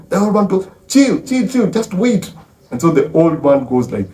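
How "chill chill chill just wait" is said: urgent placating. Retold or imitated. Old man calms enraged wife.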